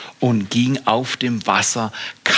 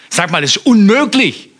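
high, loud astonished exclaim; fired-up preacher exclaiming; excited outburst; 9 semitones higher, 7 dB louder than usual. Real, not acted. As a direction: burst out in amazement. Preacher marvels at walking on water.

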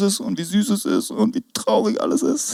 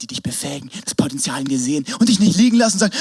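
ordinary talk, nothing marked

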